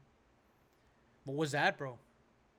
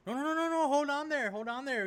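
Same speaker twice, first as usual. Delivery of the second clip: much higher, insistent mock judge; judge interrupting; 10 semitones higher, 3 dB louder than usual. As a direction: play the judge halting the defendant. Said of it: "The judge, the judge cuts him off".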